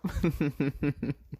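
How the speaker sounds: real wheezy cracking up, trailing away; helpless laughter. Real, not acted. Storyteller amused by his girl fleeing, helpless laughter.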